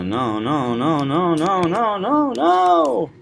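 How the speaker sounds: rising run to a high drawn-out NO; building string of noes. Acted. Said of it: "The last no, he really stretches out".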